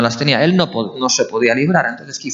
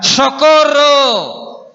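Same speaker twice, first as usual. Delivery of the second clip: high, drawn-out shout for help; desperate cry for help; 11 semitones higher, 4 dB louder, much slower than usual. Shown as a demonstration. Preacher showing what crying out means, desperate shout. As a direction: cry out as if in dire trouble. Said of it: "¿Y qué significa la palabra para clamar".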